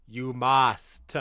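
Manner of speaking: "must" with a hard, released "st" ending; emphasis on final ST. Shown as a demonstration. Streamer demanding Arsenal win, emphasis on the "st" of "must". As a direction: stretch "must" and spit out its final "st". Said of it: "Emphasis on the st".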